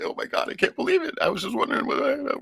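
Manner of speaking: high-pitched mock amazement; amazed stranger. Stranger amazed at the gift, high excited disbelief. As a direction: squeal it out like someone stunned.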